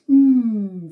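loud, drawn-out yummy hum; savouring mmm. Pretend. Storyteller smelling Cupcake Land for children, savouring hum. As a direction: hum as if smelling fresh cake.

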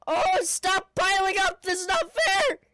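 loud, high protesting yell; whiny shout that breaks; exasperated yelling at the game; voice cracking. Real, not acted. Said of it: "my voice cracks".

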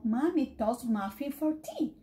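ordinary delivery, nothing marked

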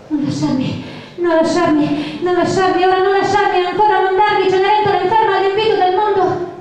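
ordinary delivery

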